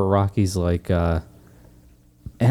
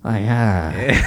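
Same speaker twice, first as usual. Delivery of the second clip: low, weary trainer's groan; hammed-up low, speechless cornerman; Mickey impression; 12 semitones lower than usual. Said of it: "And Mickey's just looking at him going".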